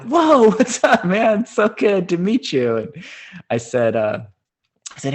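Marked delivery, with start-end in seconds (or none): 0.0-2.8 very high, loud and slow, laughing; laughing fanboy greeting; starstruck excitement. Retold or imitated. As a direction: gush like a starstruck fan. Meeting his idol at window.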